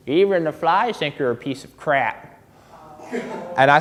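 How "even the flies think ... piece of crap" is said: put-on old lady. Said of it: "she looks at me and she says".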